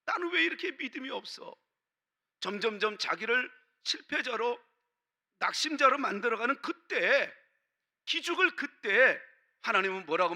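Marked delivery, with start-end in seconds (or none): none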